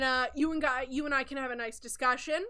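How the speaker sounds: ordinary delivery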